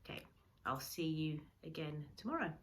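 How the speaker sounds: ordinary delivery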